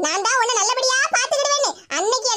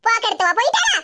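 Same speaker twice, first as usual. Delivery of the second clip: normal talking voice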